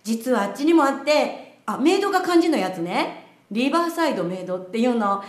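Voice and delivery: ordinary talk, nothing marked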